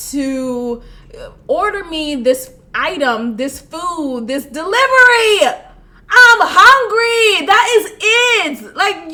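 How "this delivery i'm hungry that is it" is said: louder and higher, drawn-out "I'm hungry"; exasperated loud plea. Real, not acted. Venting to viewers about her ex, loud exasperated plea. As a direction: stretch it out like a desperate plea. Emphatic rant about care.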